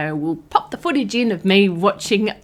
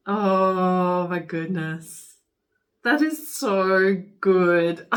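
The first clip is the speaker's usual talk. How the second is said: awed; much slower than usual. Real, not acted. Slow awed admiration.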